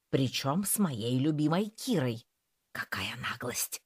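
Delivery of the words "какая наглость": outraged whisper. A narrator's reading. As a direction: hiss it under your breath. Cat fuming in whisper.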